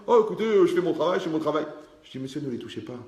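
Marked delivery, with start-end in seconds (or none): 0.1-1.7 plus haut et plus fort, agacé; le vigile qui s'impatiente; agent de sécurité agacé. Retold or imitated. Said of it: "agent de sécurité cité". (agent de sécurité buté, voix haute et forte)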